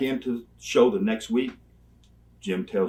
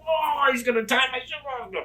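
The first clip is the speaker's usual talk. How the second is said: self-mocking whine; 14 semitones higher than usual. Mocking his own whining self.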